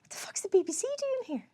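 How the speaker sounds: high whisper of disbelief; whispered bafflement; confused whisper. Retold or imitated. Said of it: "It was like".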